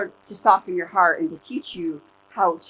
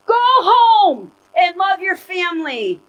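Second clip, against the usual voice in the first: loud, high, drawn-out exhortation; emphatic command; 10 semitones higher, 8 dB louder, slower than usual. Retold or imitated. Preacher quoting a nun's answer to her followers, emphatic and loud. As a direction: belt it out slowly as a quoted command.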